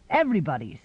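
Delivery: plain reading voice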